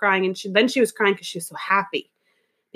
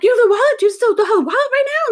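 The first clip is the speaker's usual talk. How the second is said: much higher, louder, fast urgent questions; urgent excitement; 13 semitones higher, 5 dB louder, faster than usual. Retold or imitated. Stylist to the two girls about the wallet, high urgent. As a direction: pounce on them, high and loud. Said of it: "And I'm like".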